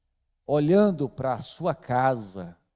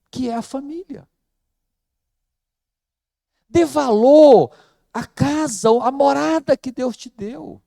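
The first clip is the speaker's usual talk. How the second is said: higher, louder full-force sermon; fiery preaching; 9 semitones higher, 7 dB louder than usual. Acted. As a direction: preach it hard, pleading with the congregation. Passionate exhortation to value family.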